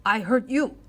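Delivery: loud, quick warning; tiny brave threatening the boy; swift threat. A narrator's reading. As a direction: snap the threat fast. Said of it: "said the Indian swiftly".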